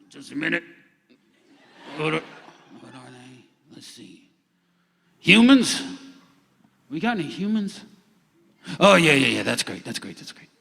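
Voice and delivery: very soft, far-off muttering; distant unseen voice. Acted. Voice heard from an empty dark room, distant and distracted. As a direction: talk to yourself from across an empty room. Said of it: "you hear a voice say".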